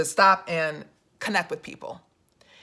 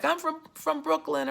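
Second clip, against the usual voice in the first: higher, softer imitation of an older woman; a hint of a little old lady; lightly voiced elderly woman; 8 semitones higher, 7 dB quieter than usual. Elderly woman tells Joe her borough, lightly imitated. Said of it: "it was an older, um, elderly woman".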